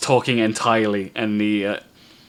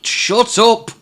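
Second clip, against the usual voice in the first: angry shout; 13 semitones higher, 8 dB louder than usual. Acted. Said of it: "clips him ... and says".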